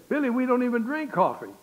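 high, loud retold objection; incredulous protest. Young Jim objecting to a coffee resolution, loudly incredulous. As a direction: pitch it up like a baffled young friend.

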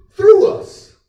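high, loud burst; preaching shout. Acted. Preacher telling the church God works through them, high shout. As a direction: fling the words up.

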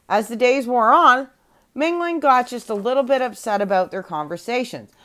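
plain reading voice

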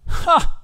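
high, breathy single "ha"; humorless laugh. A narrator's reading. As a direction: give one mirthless high laugh. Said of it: "Ravnessa gave a laugh".

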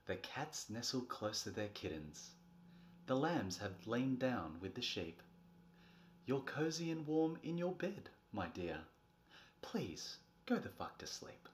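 soft, gentle lullaby read; hushed bedtime reading. Acted. Parent reading a bedtime rhyme, soft and hushed. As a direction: lull a child to sleep with the rhyme.

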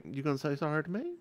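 quiet challenge to the rude daughter; pointed challenge. Retold or imitated. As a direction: throw it at her coolly and fast. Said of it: "I just looked up at her like".

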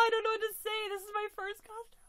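high, thrilled voice; overwhelmed. Real, not acted. First costumed doll, thrilled high voice. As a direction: sound lost for words with joy.

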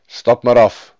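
loud reindeer guide; talking reindeer. A narrator's reading. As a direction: tell the rider firmly, as the animal. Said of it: "zei het rendier".

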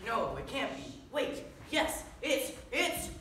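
high, loud, breathless build-up; excited onlooker. Acted. Onlooker spotting a superhero in the sky, excited. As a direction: announce a hero's arrival with mounting suspense.

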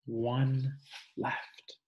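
usual speaking voice

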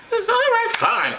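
high falsetto woman's voice; high put-on wifely voice; placated Mary. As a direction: concede in a comic high pitch. Acting Mary accepting apology.

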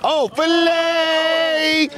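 very slow, high stretched call of Filet; drawn-out name call. Real, not acted. Commentator hyping a player's bucket, drawn-out call. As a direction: drag the name out like a hype man.

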